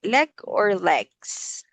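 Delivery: slow, drawn-out "leg or legs?" with the final S made clear; stress on leg or legs. Shown as a demonstration. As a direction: say "leg" and "legs" slowly, pressing the S sound. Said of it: "Okay, leg".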